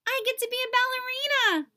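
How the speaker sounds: high, fast, thrilled; excited. A narrator's reading. Boy tells Mom he'll be a ballerina, excited. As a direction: burst out with pure joy.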